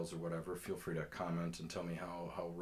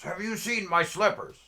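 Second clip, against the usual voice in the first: much higher, louder panhandler voice; insistent repeated question; 13 semitones higher, 15 dB louder than usual. Retold or imitated. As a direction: shout it at someone who didn't get it. Panhandler repeats question after "What?.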